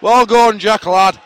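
loud, high cheer to a player; urging on. Real, not acted. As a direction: shout encouragement onto the pitch.

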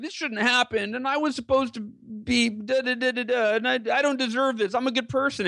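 mock the sulking victim; miserable victim. Talking to his dad while mimicking a self-pitying wheelchair user, whiny. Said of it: "be miserable in the wheelchair".

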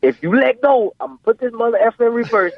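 high-pitched, quick imitation of dad's warning; threatening dad. Retold dad threatening his son.

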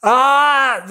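loud open-throat "ah" release; a loud sigh letting the air out; demo exhale. Shown as a demonstration. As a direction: push the air out with a loud cry-like "ah". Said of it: "O sea, llora".

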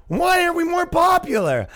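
high-pitched, pleading mock whine; hammed-up plaintive voice of a company; whining league. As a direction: complain like an unpopular kid begging for friends. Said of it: "the N H L has to speak".

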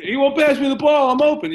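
high, loud whiny player voice; whiny bad shooter. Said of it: "Bad shooters are always open".

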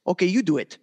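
imitating a Russian hockey coach; Russian accent. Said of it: "in his Russian voice".

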